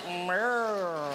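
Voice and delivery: a hint of Kenneth Williams; Kenneth Williams meow. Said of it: "If Kenneth Williams were alive today, he would go".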